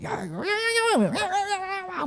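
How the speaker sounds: high, drawn-out squabbling noise; comic high-pitched bickering; mock quarrel yelling. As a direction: imitate a family row in a high squealing voice. Parent and son daily fight.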